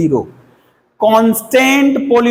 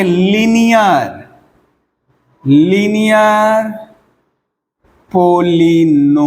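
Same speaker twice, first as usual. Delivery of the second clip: drawn-out dictation; slower than usual. Shown as a demonstration. Teacher dictating the term to students, slow and drawn out. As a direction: stretch "linear polynomial" very slowly, syllable by syllable.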